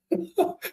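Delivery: short, soft, high laugh; amused laugh. Real, not acted. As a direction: chuckle at your own football joke. Laughs at Corinthians joke. (risada curta e divertida)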